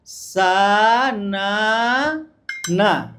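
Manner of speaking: very slow, each syllable stretched long; dragged-out reading. Retold or imitated. Teacher imitating how boys drag out their first reading. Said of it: "ছেলেরা একটু টান দিয়ে পড়বে প্রথমে".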